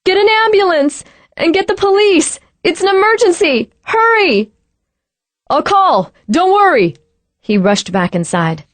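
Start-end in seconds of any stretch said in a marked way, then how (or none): 0.0-4.5 high, urgent yell, a bit fast. Acted. Adria yells to the neighbor for help, high and urgent. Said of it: "Then she yelled". 5.5-7.0 calling back. Acted. Neighbor shouts back reassurance.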